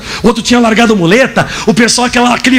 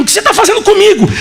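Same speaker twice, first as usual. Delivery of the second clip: high-pitched mock yell of protest; retold yelling; 9 semitones higher, faster than usual. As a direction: imitate a startled man crying out. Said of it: "ficou ainda de repente gritando". (imitando grito agudo de protesto)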